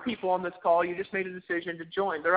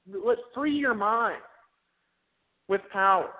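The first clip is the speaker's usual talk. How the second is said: slow, drawn-out call, then a louder punch on power; slow rallying call; 3 semitones higher, 3 dB louder, slower than usual. Acted. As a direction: stretch it out like a rallying cry. Rallying the audience to free minds.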